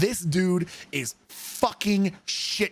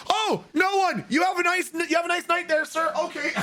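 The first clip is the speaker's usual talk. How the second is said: much higher frightened chatter; scared squeak; 12 semitones higher than usual. Retold or imitated. Scared answer to drunk soldier.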